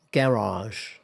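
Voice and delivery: garage stressed on the first syllable; British stress. Shown as a demonstration. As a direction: say garage as "GA-rahzh".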